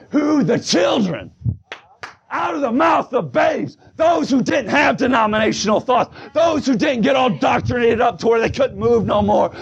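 high, driving revival preacher; full-force preaching. Real, not acted. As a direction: drive each phrase out with fervour.

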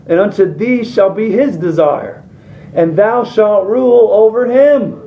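high, loud, slow preacher reading; preaching scripture. Acted. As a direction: proclaim God's words to Cain with force. Reads scripture as God's voice.

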